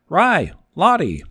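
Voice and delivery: calling out. Acted. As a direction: hail them from three doors away. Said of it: "a voice called".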